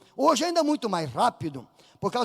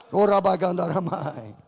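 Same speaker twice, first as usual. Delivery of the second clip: speaking in tongues; 5 semitones lower than usual. Real, not acted.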